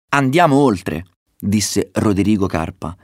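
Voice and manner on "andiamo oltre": raised, higher-pitched rallying call; rally them to push on; bold urging. A narrator's reading. Roderigo urges his brothers beyond the veil, bold call.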